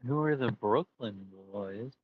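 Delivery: normal talking voice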